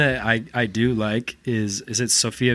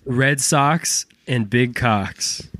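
very slow mock line; much slower than usual. Joke Boston line.

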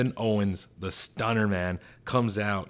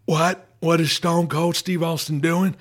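Stone Cold impression; 9 semitones higher, 6 dB louder than usual. Stone Cold ranting to McMahon on the phone, loud and high. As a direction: yell every line like a rowdy wrestler hollering down the phone. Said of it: "Stone Cold just on the other line".

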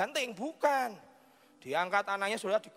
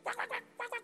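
high, soft rapid quacks; squeaky little duck noises; imitated duck quacking; 6 dB quieter than usual. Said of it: "papanya ambil bebek-bebek".

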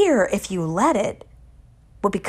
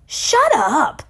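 higher, emphatic mock yell; defiant retort; 8 semitones higher, 4 dB louder than usual. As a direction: fire it back at the scary thing. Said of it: "He looks at that thing dead on".

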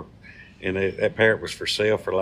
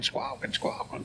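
parrot squawk imitation; 10 dB quieter, slower than usual.

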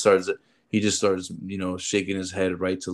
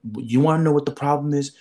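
imitating an irked mother, pitch raised; retold indignant challenge, higher voice; agitated mother; 5 semitones higher, 3 dB louder than usual. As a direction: put on an agitated mom throwing the question back. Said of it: "really agitated about even being here".

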